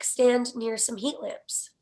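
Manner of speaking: ordinary talk, nothing marked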